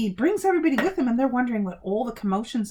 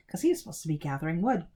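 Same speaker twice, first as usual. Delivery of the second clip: ordinary delivery, nothing marked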